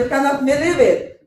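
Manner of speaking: high, loud mock British accent. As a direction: imitate a British accent, excited and amused. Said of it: "British accent". (ginagayang British accent, mataas at malakas)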